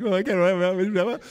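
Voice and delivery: slow, drawn-out mock whine; teasing the class with a mock whine; whiny kids. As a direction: imitate kids giving up. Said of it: "You're all like".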